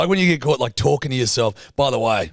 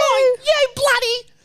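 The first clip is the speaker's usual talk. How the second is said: much higher, slow, shrieking fury; mock meltdown, screeching at the other car; hammed-up screaming; 4 dB louder, slower than usual. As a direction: play the unhinged driver as the onlooker heard him. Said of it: "that's how I would have viewed someone".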